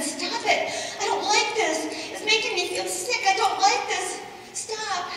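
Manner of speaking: dizzy pleading. Acted. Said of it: "and I said".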